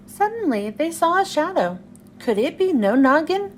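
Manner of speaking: plain reading voice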